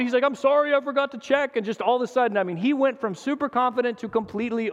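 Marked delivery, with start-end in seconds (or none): 0.2-1.6 flustered apology. Retold or imitated. Dad caught out, apologizing.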